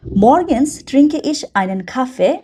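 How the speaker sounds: very slow, careful German reading; slow model reading. Shown as a demonstration. Teacher reads the German example slowly for learners. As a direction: read it out slowly so students follow. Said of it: "teacher reads example slowly".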